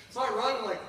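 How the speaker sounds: usual speaking voice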